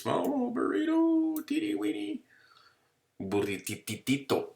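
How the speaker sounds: very high, slow cooing over something tiny; cutesy baby talk. Pretend. Teacher cooing to the class over a teeny burrito drawing. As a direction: coo it like it's adorable.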